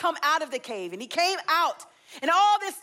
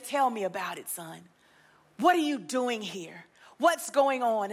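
gentle God voice; 6 semitones lower than usual. Acted. God gently asks Elijah.

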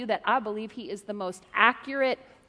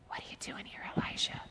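soft whispered voice of God; 13 dB quieter than usual. Shown as a demonstration. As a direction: whisper it gently. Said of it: "we want God to talk like this".

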